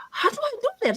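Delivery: very high, retold flustered question; half-laughing imitation of old alarm; mock panic. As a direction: squeal it like your panicked beginner self. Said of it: "I was shaking".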